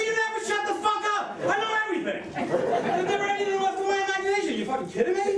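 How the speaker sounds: high-pitched, exasperated comic rant; high, indignant mock yelling; acted angry shouting. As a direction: shout back in a hammed-up quarrel. Acted quarrel, shouting back.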